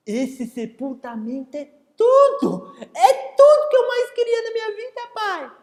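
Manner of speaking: put-on high, loud delighted kid; overjoyed child. Boy thrilled with his father's plan, high excited voice. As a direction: gush with joy like a little boy.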